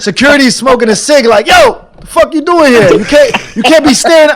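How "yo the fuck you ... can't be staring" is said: high, loud mock security guard; imitating the guard. Rude guard shooing him off, high and loud.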